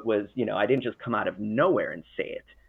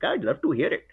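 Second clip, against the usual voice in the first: higher-pitched, exaggerated Indian accent; brief mock Indian-accented reply; thick Indian accent; 6 semitones higher than usual. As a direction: do the tech support guy with a thick Indian accent. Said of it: "with that thick of an accent".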